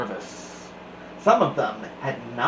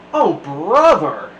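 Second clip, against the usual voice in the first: louder, slow eye-rolling oh brother; exasperated groan; 2 semitones higher, 8 dB louder, slower than usual. Acted. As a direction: drag it out, totally unimpressed. Class bored by card tricks.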